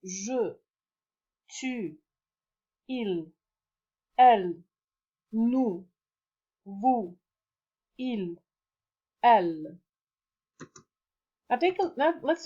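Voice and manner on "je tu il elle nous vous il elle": French pronouns one by one, long pauses between; repeat-after-me drill. Shown as a demonstration. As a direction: model each word singly, leaving room to repeat. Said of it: "please repeat after the video".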